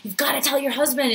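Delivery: loud, high-pitched urging; pushy inner voice, pleading loudly; insistent inner resistance. Acted. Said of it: "the resistance is telling me".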